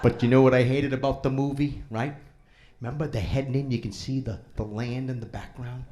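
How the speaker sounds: ordinary delivery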